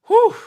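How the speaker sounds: loud, sharp exhaled whew; relieved whew. Real, not acted. Streamer relieved she didn't jinx Coco, relieved whew. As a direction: blow out the tension in one breath. Relief after a tense match.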